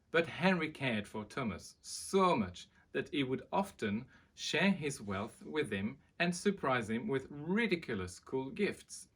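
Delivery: lively read-aloud voice; storybook narration. A narrator's reading. Reading a children's book aloud to viewers, lively. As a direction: tell it like bedtime to children.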